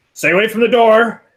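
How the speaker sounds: yelling through a door. Acted. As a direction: call out a wary order to people behind a door. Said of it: "yelling out, uh, saying".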